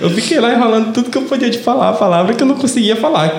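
high laughing voice. Real, not acted. Telling the airport story, laughing.

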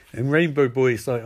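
ordinary delivery

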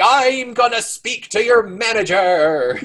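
high, slow, whiny demand for the manager; entitled customer impression. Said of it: "'Cause his name is Karen".

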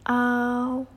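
"au" held long, high and level; pronunciation demo. Shown as a demonstration. Teacher asks students to follow along, sustained vowel demo. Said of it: "cara pengucapannya".

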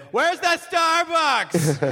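high, drawn-out tourist holler; mock American yelling across the street; loud American tourist. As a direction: bellow it like a clueless tourist abroad. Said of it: "Americans are definitely loud".

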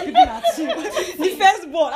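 high, loud laughter through the words; laughing. Real, not acted. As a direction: break up laughing at the memory. Laughing at her own shame.

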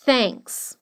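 Thanks with the tongue between the teeth; th sound. Shown as a demonstration. Teacher demonstrating the th in Thanks. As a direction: start Thanks on a soft th, not t or f. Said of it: "a língua entre os dentes pra falar o T H".

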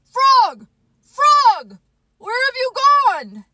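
very high, loud cries; desperate calling. Acted. Toad searching the dark for Frog, desperate cries. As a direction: call out frantically for a lost friend.

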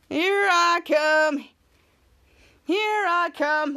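very slow, stretched sing-song; slow drawn-out. Acted. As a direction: savour each word lazily. Ray heading to his tanning bed.

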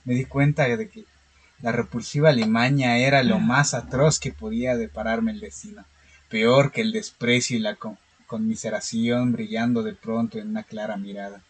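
calm, steady horror story reading; story narration. Acted. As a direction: read it like a measured horror tale. (lectura de cuento de terror)

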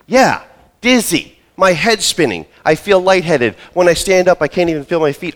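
high, loud, gushing list of symptoms; eager complaining. Retold or imitated. Patient jumping on the doctor in front of his boss, high and loud. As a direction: pile it on, shrill with eagerness. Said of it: "Oh, I'm gonna jump on him for this".

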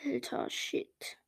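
slow, breathy whispered curse. Real, not acted. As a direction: whisper it to yourself. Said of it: "muttered whisper".

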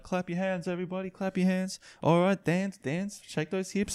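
wedding commentator urging guests to dance; hype commentator. Pretend. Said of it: "skit idea ... of the commentator".

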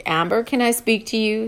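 plain reading voice

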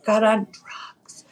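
confidential whisper. Real, not acted. Confiding about friends to podcast host, whispered. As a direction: drop into a whisper for the taboo part. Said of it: "You don't have to whisper that".

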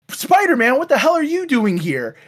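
lightly voiced indignant villain; mock Lady Vermin. The villain catching Spider-Man sneaking in, high and sharp. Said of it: "Lady Vermin goes like".

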